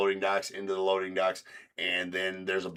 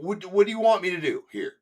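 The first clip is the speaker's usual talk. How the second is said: retold confrontation; 11 semitones higher, 6 dB louder than usual. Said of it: "I'm like".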